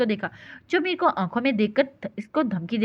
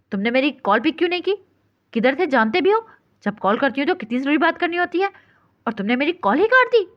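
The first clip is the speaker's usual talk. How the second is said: higher and louder, nonstop; breathless tirade; 5 semitones higher, 4 dB louder than usual. A narrator's reading. Angry caller scolds him for cutting the call, nonstop. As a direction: rattle it off without a breath. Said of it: "बगैर सांस लिए बोलती".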